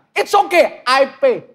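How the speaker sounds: high-toned mock bravado; high, indignant tone. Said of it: "Saya mulai dari nada saya tinggi".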